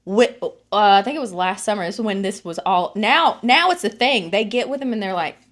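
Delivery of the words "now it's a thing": usual speaking voice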